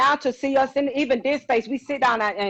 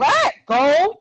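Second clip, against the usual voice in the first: surprised what; 4 semitones higher, 4 dB louder than usual. Retold or imitated. Mock surprise at gift.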